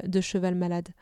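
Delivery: plain reading voice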